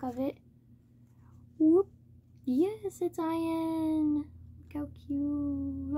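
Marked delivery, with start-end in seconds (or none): 1.6-4.2 excited cheer. Real, not acted. Happy reveal of wanted photo card.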